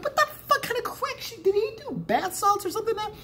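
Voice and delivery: high-pitched, exasperated disbelief; incredulous outburst. Retold or imitated. Driver watches friend jumping around, incredulous outburst. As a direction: squawk it in baffled disbelief.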